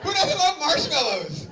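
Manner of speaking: loud, high gushing over marshmallows; excited. Real, not acted. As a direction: sell it with big enthusiasm.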